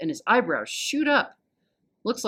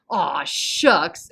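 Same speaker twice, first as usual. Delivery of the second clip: louder mock Texan cowboy "Aw, shucks"; fake cowboy accent; 5 dB louder than usual. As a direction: ham up a movie cowboy accent. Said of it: "twangy accent that shouts".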